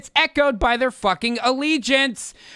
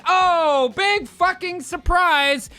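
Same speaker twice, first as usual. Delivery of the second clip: sarcastic; 8 semitones higher, slower than usual. Real, not acted. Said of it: "sarcastic remark on news".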